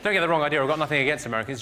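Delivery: ordinary talk, nothing marked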